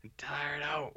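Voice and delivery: unvoiced, worn-out whisper; Nick Nolte voice. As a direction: rasp it out like a spent old actor. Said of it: "It's Nick Nolte over here".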